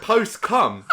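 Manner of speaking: loud, high and laughing; exasperated second go through laughter; laughing retry. Real, not acted. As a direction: blurt it out while cracking up. Retrying fluffed phrase amid laughter.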